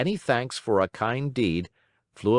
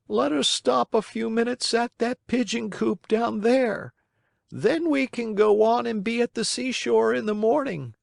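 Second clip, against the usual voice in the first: high-pitched, even storybook bird voice; pigeon making plans; 14 semitones higher than usual. Acted. Pigeon suggests a stop to the puppet, high friendly voice. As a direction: propose a plan in a high character voice.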